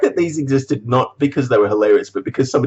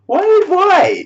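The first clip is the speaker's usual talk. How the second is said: much higher and louder despairing why; exasperated squeal; 6 dB louder than usual. Real, not acted. Vlogger despairing at his stored clutter, high exasperated cry. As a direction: wail it in mock despair.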